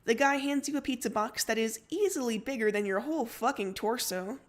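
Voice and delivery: usual speaking voice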